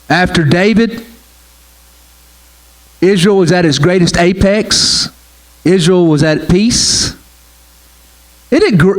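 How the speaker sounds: ordinary talk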